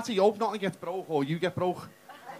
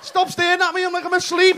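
exasperated inner shout; 10 semitones higher, 10 dB louder than usual. Retold or imitated. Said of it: "And I thought".

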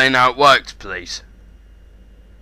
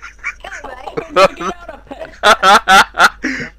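breathless laugh; 9 semitones higher than usual. Real, not acted. Laughs at accent jibe.

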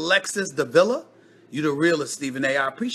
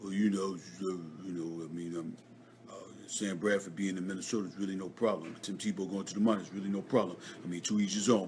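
much softer, lower mumbled Shaq impression; imitating Shaq; 17 dB quieter, faster than usual. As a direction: mumble it low and lazy like Shaq. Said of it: "Y'all want me to sound like Shaq?".

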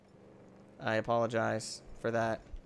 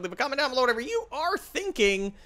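high, bright announcer lilt; sing-song outro; 12 semitones higher, 4 dB louder than usual. Real, not acted. Youtuber signing off to viewers. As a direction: toss it off like a show host.

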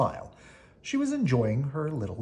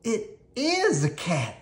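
alarmed cry; 9 semitones higher, slower than usual. A narrator's reading. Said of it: "cried Melissa".

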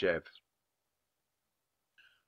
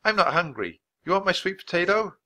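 raised, higher-pitched offer; calling out; 8 semitones higher, 4 dB louder than usual. A narrator's reading. Boy offering his lunch to Jeb, calling out. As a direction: call across with a friendly offer. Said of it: "he called out".

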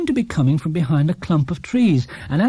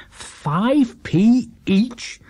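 higher, very slow, bitter disbelief; bitter exclaim; 7 semitones higher, much slower than usual. A narrator's reading. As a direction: drag out the price in disgust. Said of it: "exclaimed Paddington bitterly".

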